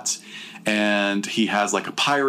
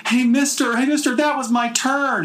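high yelling woman's voice; shrill high cry of a woman left behind; mock shouting woman. To the driver, a woman's high mock yell.

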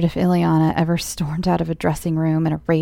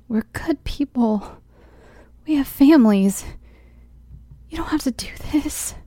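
pleading; 7 semitones higher than usual. A narrator's reading. Pleading with kidnappers.

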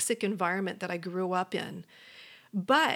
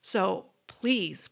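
ordinary talk

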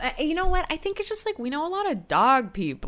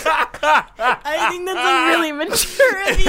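laughing; 4 semitones higher, 6 dB louder than usual. Real, not acted. Laughing hard through lines.

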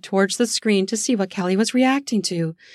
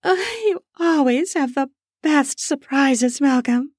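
put-on breathy and drunk; 6 semitones higher than usual. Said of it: "Her words came out breathy and drunk".

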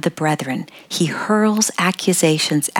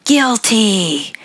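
mild judge's yell, slightly raised pitch; drawn-out mock courtroom yell; 4 semitones higher than usual. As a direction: call out the verdict like a judge, half-yelled. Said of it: "and yells".

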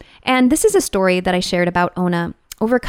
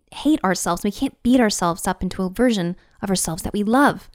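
ordinary delivery

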